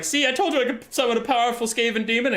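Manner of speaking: smug rat sorcerer. Retold or imitated. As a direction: gloat like a vindicated schemer. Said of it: "And Thanquol's like".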